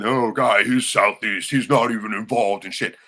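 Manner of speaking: mocking brother. Mocking how the brother dismissed Guy in court. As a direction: parrot the brother's dismissal with contempt. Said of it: "He said".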